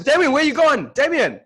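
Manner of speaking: high, loud urgent call. Real, not acted. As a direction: shout after someone walking away. Calling after co-host wandering off.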